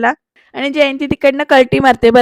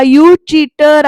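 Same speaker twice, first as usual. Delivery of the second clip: loud, very drawn-out mock yell; retold yell; 3 semitones higher, 7 dB louder, much slower than usual. Jayanti catching Samir blowing a kiss, drawn-out accusing yell. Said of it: "असं म्हणत ती ओरडते".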